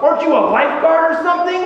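high, quick retold challenge; mock-frantic rising question; frantic demand. Frantic woman grabs him, demanding help.

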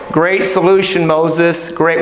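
low, deadpan mock praise; sarcastic approval. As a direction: praise it while meaning the opposite. Sarcastic grumbler to Moses.

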